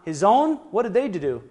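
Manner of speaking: normal talking voice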